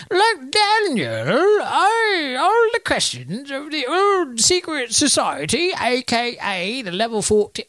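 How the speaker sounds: put-on high, slow Cockney old lady; Cockney accent. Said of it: "Why did she suddenly become Cockney?".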